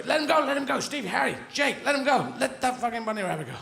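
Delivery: high, urgent calling to the other dogs; hammed-up high-pitched plea; bright greyhound. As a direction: call off your mates insistently. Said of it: "one dog bright enough to go".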